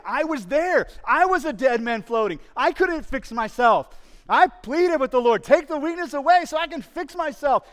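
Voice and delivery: loud, high pleading as Paul; preaching at full force. Acted. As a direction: cry it out like a drowning man.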